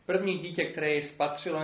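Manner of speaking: plain reading voice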